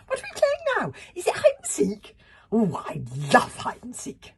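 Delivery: high, loud, eager little voice; happy squealing. A narrator's reading. Swordfly thinking it's all a game, happy squeal. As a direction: squeal with childlike delight. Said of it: "squealed Swordfly happily".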